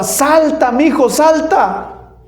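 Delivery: high, pleading cry to jump; father shouting to son under gunfire, desperate. Retold or imitated. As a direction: yell up to the child in panic. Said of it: "le gritaba".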